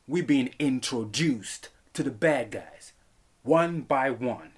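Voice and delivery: we're being introduced to the bad guys, slow and deliberate; slow emphatic. Shown as a demonstration. Reviewer repeating his point, slow and emphatic. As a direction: drag it out for emphasis. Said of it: "like I'm saying".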